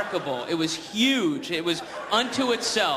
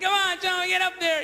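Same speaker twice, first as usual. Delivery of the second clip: trainer urging; 11 semitones higher than usual. Retold or imitated. Trainer whipping the elephant, high mock yell.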